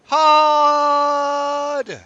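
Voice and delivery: high, very slow bellowed call; loud bellow. Pretend. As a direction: belt it out long and loud.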